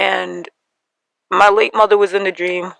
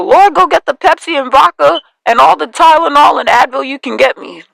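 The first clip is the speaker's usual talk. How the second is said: louder, higher dramatic plea; exasperated mother; 6 semitones higher, 6 dB louder than usual. Retold or imitated. Mother hearing of the pregnancy, loud higher exclamation. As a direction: cry out like a mom needing a stiff drink. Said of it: "I hear my mother go".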